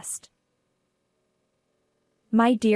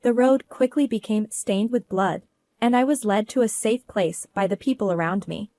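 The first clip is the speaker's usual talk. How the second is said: ordinary delivery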